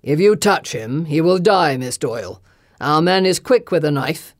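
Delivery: loud, cold threat of a lord; menacing lord. Acted. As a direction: threaten her from above. Cult lord threatens from gallery.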